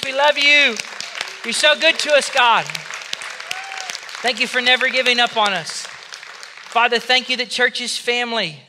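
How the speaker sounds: loud, high shouted prayer to God; exultant preacher calling out at full voice; full-force praise. Real, not acted. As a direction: cry out praise over the whole congregation. Shouted praise leading the congregation.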